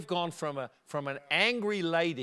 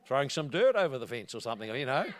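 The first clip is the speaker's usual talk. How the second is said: usual speaking voice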